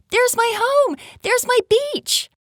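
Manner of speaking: put-on high, loud, excited child's yell. A narrator's reading. Little girl spotting her home from an orca's back, excited high yell. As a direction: call out in a high, thrilled voice. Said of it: "she yelled out".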